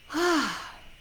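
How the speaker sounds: big, high exhaled sigh; audible sigh. Real, not acted. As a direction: let the breath out audibly. Yawning and trying to breathe.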